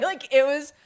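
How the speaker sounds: loud laugh on the words. Real, not acted. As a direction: break into laughter. Laughing at the memory.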